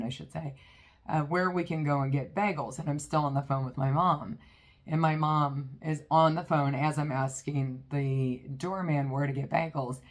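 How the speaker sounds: ordinary talk, nothing marked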